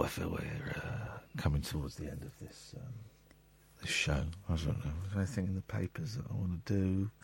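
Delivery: usual speaking voice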